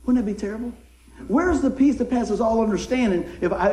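ordinary talk

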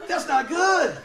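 high, loud gasp of dread; hammed-up scared realization; comic alarm. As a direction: yelp it as trouble dawns. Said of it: "but I was scared".

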